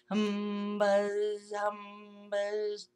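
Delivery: steady mock insect drone; a flat droning buzz; droning hum and buzz. A narrator's reading. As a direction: hum it like buzzing wings. Buzzing sound made by voice.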